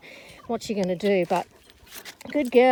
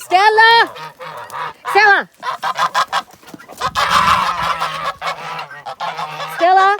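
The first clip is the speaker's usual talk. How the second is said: shouting; 6 semitones higher, 6 dB louder than usual. Real, not acted. Calling the dog repeatedly.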